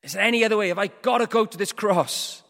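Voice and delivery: acted anguished plea, loud and high; crying out. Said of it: "he's crying out to God".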